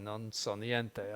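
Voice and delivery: Non so niente said slowly in Italian; slow, careful Italian Non so niente; Italian phrase. Shown as a demonstration. To the class, slowly showing the Italian. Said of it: "in Italian".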